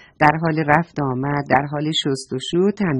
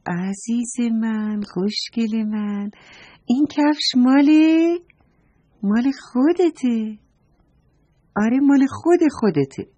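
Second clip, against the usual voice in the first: tender mother; 5 semitones higher than usual. A narrator's reading. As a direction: coax gently, like a mother to her little boy. Mother gently explains to her son.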